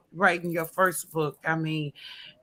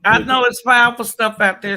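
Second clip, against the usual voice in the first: excited; 5 semitones higher, 10 dB louder than usual. Real, not acted. Enthusiastic endorsement, much louder.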